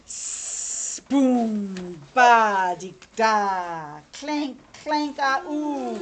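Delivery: loud booms, then clanks and an ooh; imitated story noises. Reader making the noises round the corner, theatrical. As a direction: sound out each noise like an effect.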